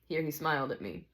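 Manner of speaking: ordinary delivery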